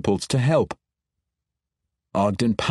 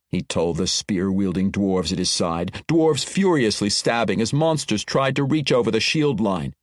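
usual speaking voice

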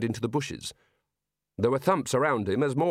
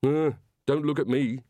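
usual speaking voice

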